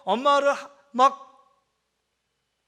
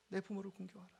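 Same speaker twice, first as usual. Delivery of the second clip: soft echo; 5 semitones lower, 23 dB quieter, faster than usual. Shown as a demonstration. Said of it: "같이 한번 읽어볼까요".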